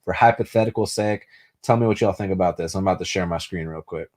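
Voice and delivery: ordinary talk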